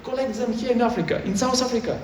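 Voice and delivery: lightly voiced exasperated pitch to data centers; retold urging.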